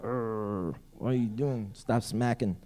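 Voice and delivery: soft grumble of mild disgust; mock annoyed muttering. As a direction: mutter a half-hidden complaint. Acts out irritation at noisy eater.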